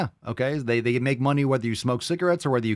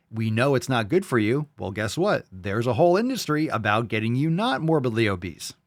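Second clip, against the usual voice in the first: usual speaking voice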